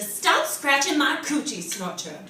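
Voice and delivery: loud, life-threatening scolding; scary loud voice. Acted. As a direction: bark it loud, like a menacing mother. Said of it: "in a scary, loud, life-threatening voice".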